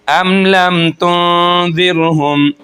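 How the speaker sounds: slow recitation, the नून of तुनज़िरहुम hidden nasally; ikhfa recitation. Shown as a demonstration. Teacher reciting the example verse. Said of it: "फिर इख्फा हो रहा है".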